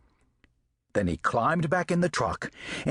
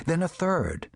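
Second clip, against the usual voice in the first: usual speaking voice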